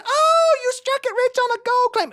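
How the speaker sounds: very high, squealing wife voice; high put-on wife voice; delighted wife. Wife's delighted reply.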